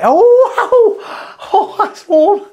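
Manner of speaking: high, yelping laugh at the heat; laughing surprise. Real, not acted. Tradesman touching a hot drill bit, laughing yelp. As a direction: caught off guard, laughing it out.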